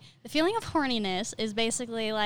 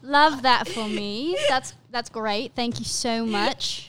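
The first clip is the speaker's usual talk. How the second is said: slow, mock-gracious British accent; drawn-out Downton Abbey thanks; sarcastic thanks in a put-on British accent; 4 dB louder, slower than usual. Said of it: "Downton Abbey vibes just now".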